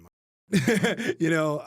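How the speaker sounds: words broken up by a loud laugh; slow, chuckling trail-off. Real, not acted. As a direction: laugh your way through it. Laughing mid-story.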